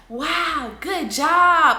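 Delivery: high, loud cheering "good job"; excited praise. Shown as a demonstration. Mom praising toddler after potty, loud high cheer.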